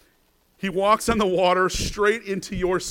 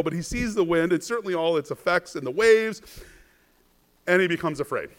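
normal talking voice

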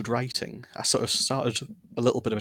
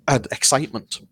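usual speaking voice

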